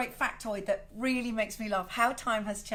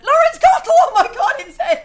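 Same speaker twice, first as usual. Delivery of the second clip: excited greeting; 13 semitones higher, 19 dB louder than usual. Real, not acted. Spots a friend joining live.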